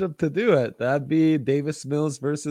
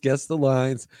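ordinary talk

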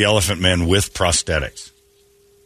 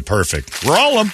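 normal talking voice

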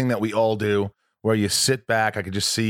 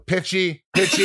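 high, laughing verdict repeated; mock vocal coach, laughing; 9 semitones higher than usual. Coach repeating his note through a laugh. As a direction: tease it out like the coach. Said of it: "He'd stop and go".

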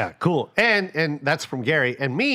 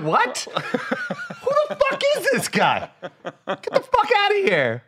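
high, stretched, laughing disbelief; mock-shocked, high and slow through a laugh; incredulous outburst; slower than usual. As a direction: squeal it out like you can't believe the nerve. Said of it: "And I'm like".

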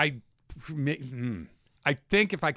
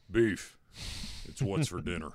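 Sam Elliott impression; 9 semitones lower, 5 dB quieter than usual. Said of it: "do your Sam Elliott impression".

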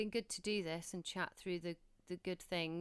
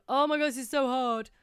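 louder, higher put-on wail of "Oh my God, this is so hard"; mock exasperated moan; 5 semitones higher, 9 dB louder than usual. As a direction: ham up the overwhelmed mum moaning. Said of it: "it's so easy to go".

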